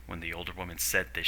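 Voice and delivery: usual speaking voice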